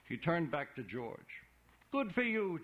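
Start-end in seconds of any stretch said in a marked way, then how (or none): 1.9-2.6 congratulating. A narrator's reading. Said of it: "he congratulated him".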